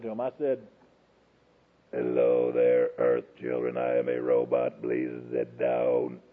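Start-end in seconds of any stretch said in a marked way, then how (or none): 1.9-6.2 very low, slow, mechanical monotone; robot voice. Pretend. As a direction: drone it out like a toy robot. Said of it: "My mama ain't never seen a robot".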